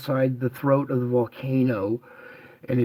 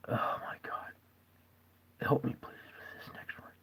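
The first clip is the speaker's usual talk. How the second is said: whispering; 14 dB quieter than usual. Real, not acted. Struggling with the video.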